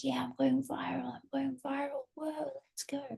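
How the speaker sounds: excited whisper, very soft; whispered celebration. Retold or imitated. Creator retelling her private celebration of going viral, excited whisper. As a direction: cheer under your breath. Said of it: "I'm celebrating".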